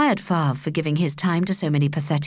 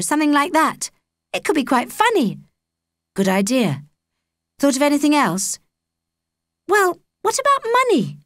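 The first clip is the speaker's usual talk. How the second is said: put-on higher girl's voice, lively; Holly's voice; 7 semitones higher than usual. A narrator's reading. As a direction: play Holly chatting to Jake, higher.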